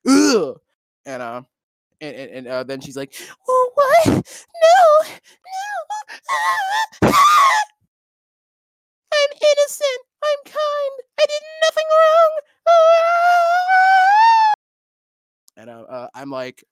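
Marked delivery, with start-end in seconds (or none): 0.1-0.6 frustrated ugh. Retold or imitated. Exploding at the racist girl. 3.5-14.5 shrill falsetto whining, hammed-up; mock wailing victim. Mocking the racist's innocent act.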